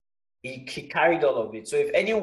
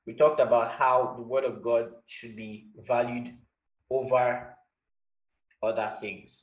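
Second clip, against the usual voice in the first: ordinary talk, nothing marked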